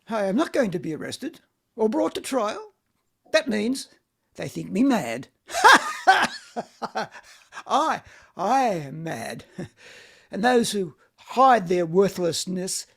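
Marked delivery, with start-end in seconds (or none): 5.5-7.2 mad laugh. Acted. Vanya laughing madly.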